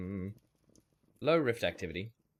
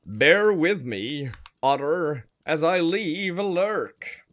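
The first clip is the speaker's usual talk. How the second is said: slow, loud, higher put-on voice; Elmo's traditional accent; 7 semitones higher, 11 dB louder, slower than usual. Streamer voicing chatter from Elmo, loud slow put-on voice. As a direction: drag out each word in a put-on voice. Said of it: "says Elmo in his traditional accent".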